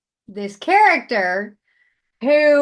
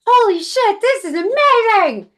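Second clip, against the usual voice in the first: high, loud, gushing outburst; unhinged excitement; 10 semitones higher, 6 dB louder than usual. Retold or imitated. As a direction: go wild with enthusiasm. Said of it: "absolutely unhinged".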